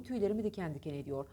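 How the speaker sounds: ordinary talk, nothing marked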